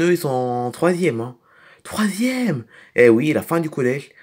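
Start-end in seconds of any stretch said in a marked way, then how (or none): 1.8-2.6 higher exclamation of surprise; astonished. Acted. Child hearing the older ones' class, astonished. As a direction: echo it in amazement, pitched up.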